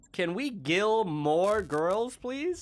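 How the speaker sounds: high, slow drawn-out quote; Spencer voice. Retold or imitated. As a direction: drawl it out in a high voice. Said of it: "says Spencer".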